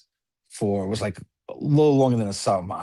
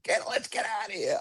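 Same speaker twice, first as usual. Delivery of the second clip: embarrassed aunt; 12 semitones higher, 7 dB quieter than usual. Retold or imitated. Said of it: "My aunt's beat red. She's pulling us".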